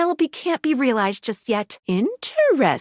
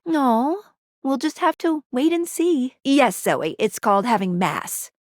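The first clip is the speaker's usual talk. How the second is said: usual speaking voice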